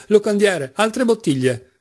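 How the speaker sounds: high call to the innkeeper; exclaimed order. A narrator's reading. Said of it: "esclamava Mitya".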